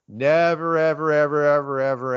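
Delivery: "Never, ever, ever" held loud in one long run. Real, not acted. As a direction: stretch "Never" and chain each "ever" onto it without a break. Drawn-out emphatic denial, then wait for it.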